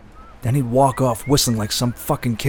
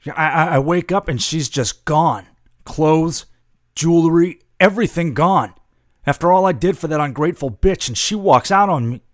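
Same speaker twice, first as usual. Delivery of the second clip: raised, louder, aggrieved rant; bitter outrage; 5 semitones higher, 5 dB louder than usual. Acted. Husband whose wife just ran out, bitter outrage. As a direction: spit out the hurt of being walked out on.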